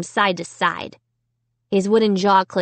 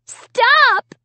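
high, loud cry; 6 dB louder than usual. A narrator's reading. Child trying to twist free of the dummy, crying out. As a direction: shout it high and desperate. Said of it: "I cried".

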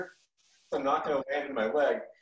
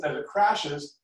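normal talking voice

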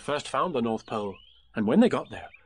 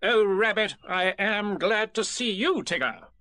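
high, bossy little rabbit voice; fussy Rabbit; 8 semitones higher, slower than usual. Retold or imitated. Rabbit pressing Piglet to agree, bossy and high. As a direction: lay out the plan like a busy organiser. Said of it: "Rabbit imagines Tigger".

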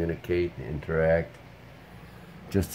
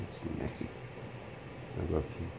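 softer breathy whisper of affection; whisper to dog; 13 dB quieter, faster than usual. Real, not acted. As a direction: whisper it close to the dog's ear.

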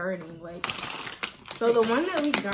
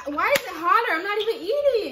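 higher, louder laughing complaint; laughing disbelief; 11 semitones higher, 6 dB louder than usual. Real, not acted. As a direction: laugh out the question. Spice burning, laughing.

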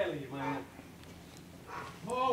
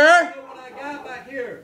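shouting; 13 dB louder than usual. Real, not acted. Suspect yelling threat.

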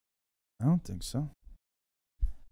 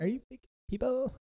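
squeaky high mock imitation; 14 semitones higher, 6 dB quieter than usual. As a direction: echo it in a silly high voice. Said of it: "I love he goes".

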